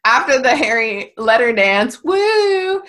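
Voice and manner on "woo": mock woo. Harry's letter dance.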